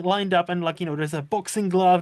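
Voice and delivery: ordinary talk, nothing marked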